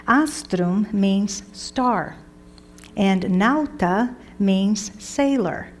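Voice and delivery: slow, deliberate teaching pace; slow dictation. Shown as a demonstration. Teacher dictates Latin meanings slowly to students. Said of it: "slow dictation of Latin meanings".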